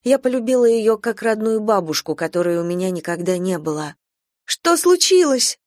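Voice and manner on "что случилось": high, loud, slow anguished cry. A narrator's reading. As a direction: plead through tears. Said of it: "заплакала я".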